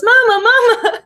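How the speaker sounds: put-on high, loud little-boy call with a laugh; laughing as she voices the little boy; little boy calling mama. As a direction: imitate a small child calling for his mother. Child voiced calling his mother.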